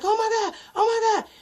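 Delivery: high, loud panicked cry; mock panicked sister; screaming. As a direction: scream at the crash. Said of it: "my sister was just screaming".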